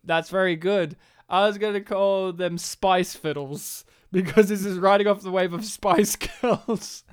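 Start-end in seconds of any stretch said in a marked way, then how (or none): none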